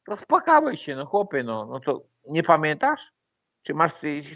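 ordinary talk

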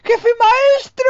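very high, slow, shrill squeal of a cheap dub; mocking dub voice. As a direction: squeal like a stingy puppet. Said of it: "Con la voz de muñeco rácano".

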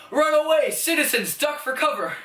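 high, loud hero warning; heroic shout. Pretend. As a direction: call out like a hammy superhero. Hero warning game civilians.

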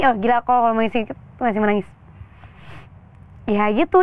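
ordinary talk

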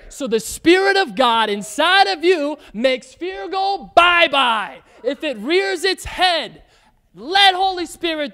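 loud, high preaching call; preaching shout. Real, not acted. Preacher declaring fear leaves, loud and high. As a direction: drive it home to the church.

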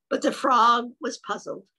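ordinary delivery

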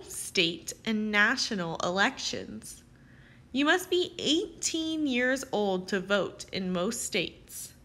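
usual speaking voice

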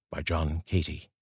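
reading a poem aloud with long pauses between lines. Acted. Reading John Cady's poem for Carl's poetry corner, unhurried poem recital. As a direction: let each line land, then pause before the next.

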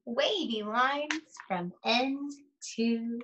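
put-on slow, sing-song read-aloud to young children; lingering on each rhyme, long pauses between pages; story read aloud. Reads picture book aloud slowly.

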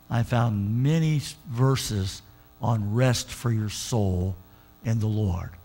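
low and drawn out, almost tender; hushed, slow testimony. Acted. Pastor softly sharing verses with the congregation, slow and low. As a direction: let it sink in slowly.

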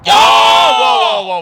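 huge roaring yell at the play; excited shout. Real, not acted. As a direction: belt it out full volume. Caster erupts at big play.

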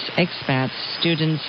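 ordinary talk, nothing marked

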